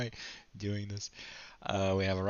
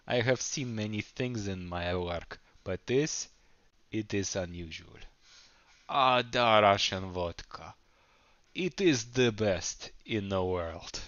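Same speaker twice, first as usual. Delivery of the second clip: hammed-up Russian accent, louder and slowed; 5 dB louder than usual. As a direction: play the smooth, confident Russian doctor with a heavy Russian accent. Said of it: "A smooth and confident Russian accent".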